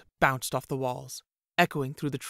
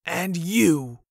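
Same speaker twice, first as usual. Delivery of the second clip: a sudden louder rounding on him; accusing; 3 semitones higher, 8 dB louder than usual. A narrator's reading. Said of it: "berated the others".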